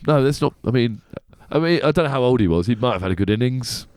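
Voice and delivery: ordinary talk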